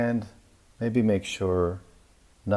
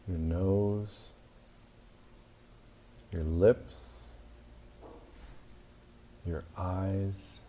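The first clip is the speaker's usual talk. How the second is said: calm, measured meditation voice with long pauses; name each body part evenly, leaving space after it; guided body scan; 5 dB quieter than usual. Acted. Yoga teacher leading listeners through yoga nidra, calm guided meditation.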